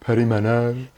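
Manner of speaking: perimeno in a Brummie accent, high and drawn. Shown as a demonstration. Host shows her Brummie way of saying it, Brummie accent. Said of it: "I can only say perimenopausal in a Brummie accent".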